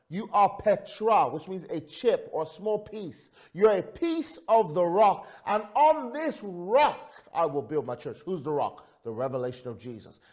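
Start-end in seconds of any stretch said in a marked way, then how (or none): 0.1-8.2 high, loud, stretched-out key phrases; impassioned sermon build; full-force preaching. Real, not acted. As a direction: drive the point home from the pulpit.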